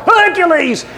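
high, drawn-out crowd chant; cheering crowd. Retold or imitated. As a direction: imitate an arena cheering its hero. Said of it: "all the audience would".

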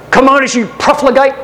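high-pitched accusing address; attacker's challenge. Acted. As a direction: confront the emperor as his assassin. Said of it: "and said".

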